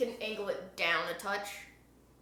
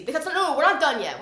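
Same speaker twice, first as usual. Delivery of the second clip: louder, punchy insistence; emphatic; 5 semitones higher, 9 dB louder than usual. Real, not acted. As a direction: stress the not, firmly. Stylist still cutting, emphatic.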